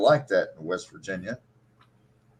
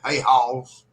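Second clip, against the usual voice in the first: Kentucky drawl; 3 semitones higher, 4 dB louder than usual. Shown as a demonstration. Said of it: "like, H A W L S".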